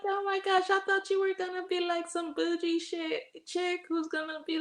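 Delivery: high-pitched imitation of an astonished friend; amazed confession. Friend's astonished confession, acted quote.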